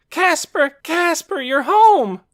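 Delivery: very high, loud excited scream; Torin screaming. A narrator's reading. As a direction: shriek a thrilled welcome to a brother. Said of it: "Torin's voice screaming".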